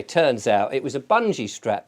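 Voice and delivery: normal talking voice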